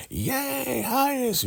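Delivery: very high, thin drawn-out cheer; mock cheering. Followers cheering the arriving Highest, mock cheering. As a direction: imitate a crowd cheering in falsetto. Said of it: "So everybody's cheering".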